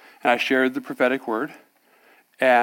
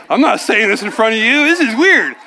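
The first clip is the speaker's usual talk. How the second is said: loud, high-pitched balking; acted protest; 7 semitones higher, 8 dB louder than usual. Man refusing to say it before his pastor, acted protest. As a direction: push back in a raised, half-laughing panic.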